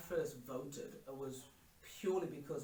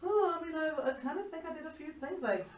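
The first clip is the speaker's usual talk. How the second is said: very high, loud, hammed-up protest; mock defensive; 10 semitones higher, 8 dB louder than usual. Child measuring up to parents' achievements, hammed-up defensive. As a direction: protest in a high, put-on voice, unsure of the claim. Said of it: "And I'm just like".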